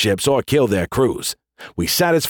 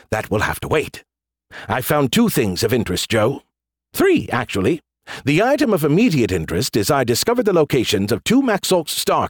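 smug AI building up his news; Skippy. Acted. AI revealing his finds to the general, self-important. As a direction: announce findings with showy, swooping emphasis.

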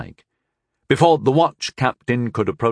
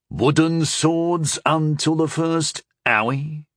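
slow, higher-pitched mocking suggestion; wry, mock-childish jest; wry quip; 5 semitones higher, slower than usual. A narrator's reading. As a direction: draw it out drily, teasing. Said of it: "quipped Vren wryly".

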